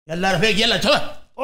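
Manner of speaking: loud guard barking at prisoners to hurry; guard yelling. Acted. Guard shouts at inmates to move: loud barking orders. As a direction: shout them along like a jail guard.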